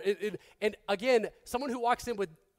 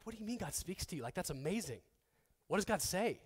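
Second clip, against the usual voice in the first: hushed wonder; 2 semitones lower, 10 dB quieter than usual. Retold or imitated. Newcomer amazed God speaks to them.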